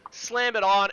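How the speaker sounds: usual speaking voice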